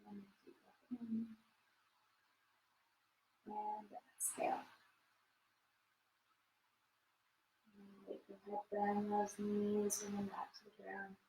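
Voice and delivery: hushed, calm yoga cue; soft spa whisper. Acted. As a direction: lull them into rest. Guides breathing in cool-down.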